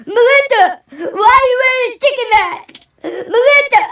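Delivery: high, loud hysterical shriek with laugh-sobs; panicked screaming. Retold or imitated. Tripping man screaming at a friend in a chicken mask, hysterical shriek. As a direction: scream her name like you're losing your mind.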